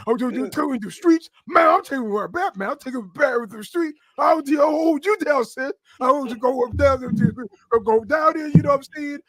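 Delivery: high, shouted, slurry wrestler impression; Ahmed Johnson. Guest mimics wrestler's street-talk promo for host, wrestler impression. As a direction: rant like a barely intelligible wrestling promo. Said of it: "That's how he sounds, apparently".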